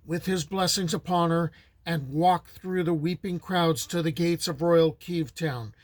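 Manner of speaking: ordinary delivery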